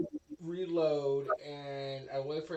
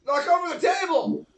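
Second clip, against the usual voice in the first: very loud, high-pitched command; shouted order; 14 semitones higher, 17 dB louder than usual. Acted. Player ordering an ally to knock over the table, shouting. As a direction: bellow it across the fight.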